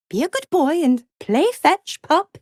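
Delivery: high, sing-song talk to a puppy; condescending dog-talk. Acted. Devil sending her pet to fetch, condescending dog-talk. Orders him about like a dog.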